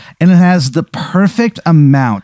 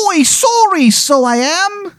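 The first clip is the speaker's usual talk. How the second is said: high, hammy Irish brogue; apologetic leprechaun in a fake Irish lilt; bad Irish accent. Leprechaun ghost apologizing to confused player, bad Irish accent. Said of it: "His, his accent is terrible".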